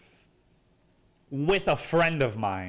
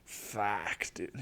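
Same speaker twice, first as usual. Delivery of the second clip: hushed dread; 3 semitones lower, 9 dB quieter than usual. Retold or imitated. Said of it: "It's like".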